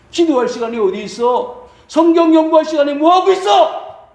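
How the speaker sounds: acted scolding, rising to a high shout; escalating rebuke. Preacher rebuking those neglecting prayer and Bible study, escalating rebuke.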